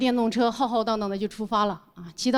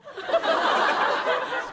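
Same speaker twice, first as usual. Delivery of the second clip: breathless laugh. Real, not acted. 笑出声.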